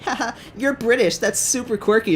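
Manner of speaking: put-on high girly voice with a tittering laugh; mocked giggly girl. Girl to the British guy on her team, high giggly mockery. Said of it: "she gets into a scuffle with him".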